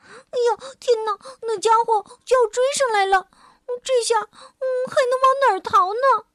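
high, fast frightened cry; desperate little voice on the run; panicked. Acted. Little dinosaur chased, panicking. (小恐龙惊慌失措地快说)

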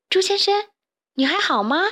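high, careful concerned call; rousing someone. A narrator's reading. Carmen trying to wake the pig, concerned. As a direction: check on him in a lifted voice. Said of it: "想唤醒他".

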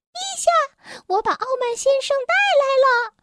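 high, quick elf report to royalty; elf addressing king. A narrator's reading. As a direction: announce it to His Majesty in a high voice. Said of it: "小精灵深深地鞠了一躬".

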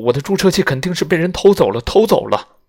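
doctor protesting his stolen syringe to the suspicious group; agitated. Acted. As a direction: blurt it out in a panic. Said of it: "阿姆斯特朗激动地说".

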